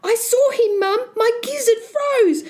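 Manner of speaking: put-on high-pitched panicky young Jack; gasping fright. Boy telling mum of something nasty, high frightened cry. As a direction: blurt the scare out high to Mum. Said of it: "he gasped".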